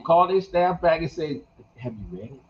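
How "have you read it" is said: low, hushed, gentle question; soft voice. Shown as a demonstration. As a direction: ask it very softly, pitched low. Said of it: "ask 'em in a soft voice".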